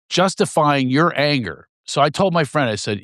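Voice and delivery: ordinary talk, nothing marked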